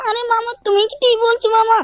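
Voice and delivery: put-on high, pleading little-boy voice; Shinchan child voice. Shinchan nagging his uncle, high childish voice. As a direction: pitch way up and whine at mama like a small kid.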